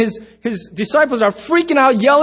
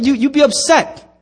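ordinary talk

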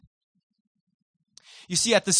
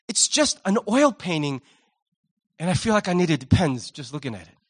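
normal talking voice

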